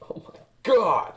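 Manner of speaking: breathless high whispered delight; excited gasp. Real, not acted. Finding the skinning satisfying, a breathless high whispered gasp. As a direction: gasp it out in thrilled glee.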